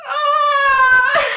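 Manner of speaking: long, high, loud scream; hammed-up wailing scream; horrified scream. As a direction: let out a drawn-out shriek of disgust. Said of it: "And then I went".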